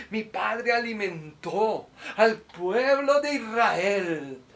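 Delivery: high, drawn-out grandiose declaration; theatrical, slow, swelling defiance; mock defiant boast. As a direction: stretch each phrase in a hammed-up retort to Satan. Acts boast Jesus never made.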